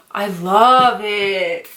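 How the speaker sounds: drawn-out, gushing adoration. Real, not acted. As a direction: stretch it out with love. Gushing over favourite scented product.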